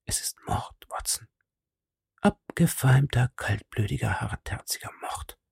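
hushed urgent whisper, mostly breath; tense detective whispering a warning. A narrator's reading. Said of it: "Flüsternd antwortete Holmes mir".

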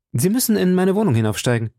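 urgent and quick; a hint of alarming urgency. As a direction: press her hard, no time to waste. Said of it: "Sie sagen mir das in einem Ton, der mir Angst einflößt".

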